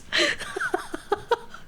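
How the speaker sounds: high squealing laugh. Real, not acted. Streamer bursts out laughing recalling the call. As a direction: let a big laugh burst out. Cracking up at coworker story.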